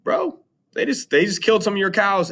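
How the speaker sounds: squeaky high mock voice; a lady tattling to Helios about his cows. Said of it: "she's like".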